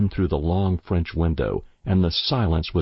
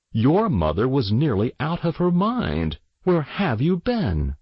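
higher, mildly indignant telling-off; scolding father; 6 semitones higher than usual. A narrator's reading. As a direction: demand an explanation like a cross parent. Father scolds daughter, demands answer.

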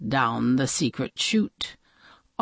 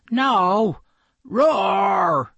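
monster roar; 3 semitones higher, 4 dB louder than usual. Acted. Monster answers, roaring.

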